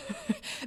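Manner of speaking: soft unvoiced laugh; little breath of a laugh; quiet chuckle while telling; breathy chuckle. Real, not acted.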